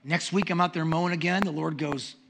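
ordinary talk

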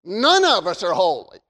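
ordinary talk, nothing marked

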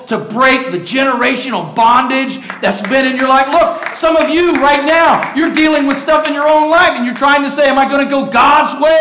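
climbing pulpit cry; building preaching. Acted. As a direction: build the challenge to a peak. Preacher rising in fervor.

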